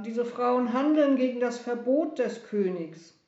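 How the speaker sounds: high, emphatic objection; soldier's loud retort; loud protest. A narrator's reading. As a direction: insist on the king's ban.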